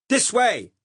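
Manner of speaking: loud, high bluffing yell; shout. Acted. Narrator still bluffing the Saxon cop, loud shout. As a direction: bluff to the very end, yelling. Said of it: "I shouted".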